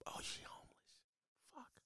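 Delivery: breathy whisper under the breath; whispered aside. Real, not acted. To the crowd, realizing she lives on the couch, whispered aside. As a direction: let it slip out as a shocked whisper.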